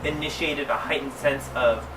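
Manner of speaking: usual speaking voice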